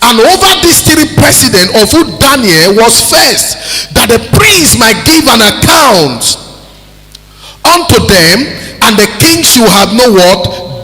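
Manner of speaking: loud, high declaimed Bible reading; preacher's scripture reading. Acted. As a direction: proclaim the scripture at full force.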